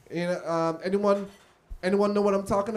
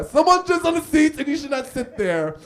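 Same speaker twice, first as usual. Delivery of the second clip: loud warning; 7 semitones higher, 7 dB louder than usual. Retold or imitated.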